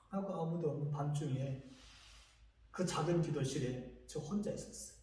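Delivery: usual speaking voice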